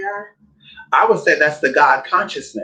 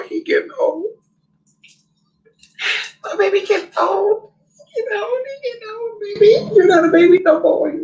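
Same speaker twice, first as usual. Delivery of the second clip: much higher, cooing pet voice; 3 dB louder than usual. Real, not acted. Talking to her aging dog in a high cooing voice. Baby talk to old dog.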